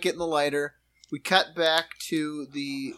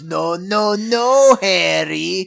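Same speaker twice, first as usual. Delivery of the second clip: loud, exaggerated "no, no, no" with an odd accent; mock refusal; 3 semitones higher, 6 dB louder, slower than usual. Podcaster imitating Harry refusing Yakov, hammed-up. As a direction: ham up the refusal, the last "no" jumping.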